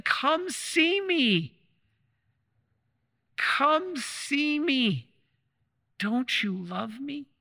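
very slow, high and tender, drawn-out longing; gentle plea. Acted. The Lord in the tabernacle to the faithful, a slow tender plea.

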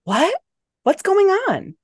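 high, startled questions; alarmed. A narrator's reading. Man suddenly tackled by his companion, alarmed. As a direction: jolt awake into alarm, pitch shooting up. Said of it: "He sounded alarmed".

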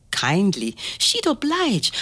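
ordinary delivery

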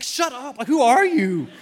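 high, loud comic indignation; mock-outraged snap. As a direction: snap at a nosy little kid, hammed-up. Dad snaps at child's little friend.

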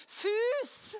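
high-pitched mock holler; retold call-out. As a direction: call out the one word across a queue. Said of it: "så roper han".

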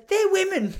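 high, loud mock child voice; protesting kid. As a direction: blurt it out as an indignant child would. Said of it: "one other kid goes".

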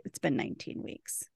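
soft, breathy-quiet stated fact; a pained near-whisper; hushed grief. Retold or imitated. Doc telling Waverly the time, hushed and pained. Doc's grief over Alice.

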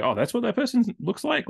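high, surprised "oh"; sudden surprised discovery; surprised realization. Retold or imitated. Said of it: "We go".

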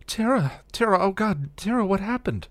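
high, frantic pleading; alarmed, high-pitched questioning; panicked. A narrator's reading. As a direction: call the name urgently in panic. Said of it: "panicked as she shook".

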